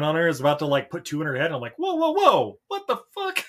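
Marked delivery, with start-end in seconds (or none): 1.8-3.4 high, startled whoa; shocked high exclamation. Retold or imitated. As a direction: react in disbelief, pitched up. Voicing his own shocked reaction.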